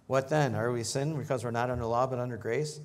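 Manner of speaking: loud rhetorical question read out; scripture reading. Acted. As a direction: read the verse to the congregation. Reads scripture aloud.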